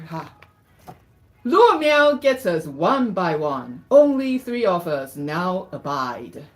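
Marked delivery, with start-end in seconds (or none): 1.4-6.4 deliberate, dramatic verse reading; story read-aloud. Acted. Reads picture book aloud.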